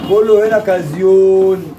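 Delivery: slow, drawn-out market call; arabic street vendor cry. Acted. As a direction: stretch it like a hawker. Seller calling out to customers.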